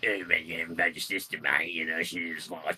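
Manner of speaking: a hint of a taunting goalie; lightly voiced hollow taunting the player; mocking hollow voice. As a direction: jeer at him in a put-on voice. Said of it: "that voice and your accent's a bit much".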